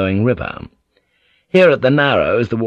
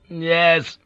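bitter agreement; 8 semitones higher than usual. A narrator's reading. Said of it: "Ninjin agreed bitterly".

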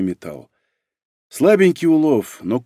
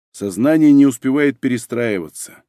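ordinary delivery

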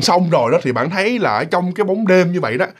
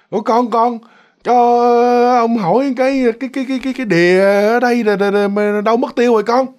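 put-on higher, stammering old man's voice; feeble old man; 5 semitones higher than usual. Old man asks boy about the pond, feeble and faltering. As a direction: stumble over words like a frail elder. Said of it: "một cái giọng người già, mà cái giọng nó rất là yếu ớt".

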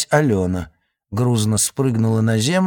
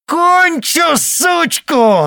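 much higher, louder frantic shriek; screaming; 6 dB louder, much slower than usual. Acted. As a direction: scream at the top of her lungs. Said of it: "надрывалась она".

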